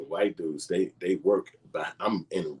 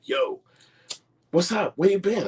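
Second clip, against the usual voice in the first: much higher put-on voice, overeager; 6 dB louder than usual. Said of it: "He like".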